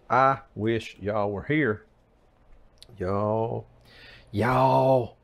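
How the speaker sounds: lightly put-on Southern cooking host; a hint of a drawling TV cook; Paula Deen. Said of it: "I sound like Paula Deen".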